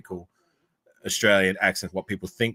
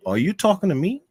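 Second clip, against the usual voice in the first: put-on higher-pitched American accent, rising into the question; American accent attempt; 9 semitones higher, 4 dB louder than usual. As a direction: try the movie challenge in an American accent, pitched up. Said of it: "best American accent".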